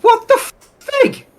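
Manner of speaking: high, loud, drawn-out disbelief; incredulous outburst. Retold or imitated. Retelling his reaction to a beggar's card machine, incredulous. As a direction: blurt it out high, stretching the words.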